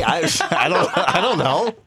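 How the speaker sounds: stumbling through a rising giggle; laughing. Real, not acted. Host laughing through his wrong guesses.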